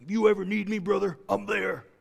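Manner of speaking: imitating a macho wrestler's pledge; Hulk Hogan. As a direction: pledge loyalty like a pro-wrestling strongman. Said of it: "You sound like Hulk Hogan".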